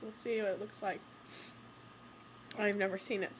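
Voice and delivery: ordinary talk, nothing marked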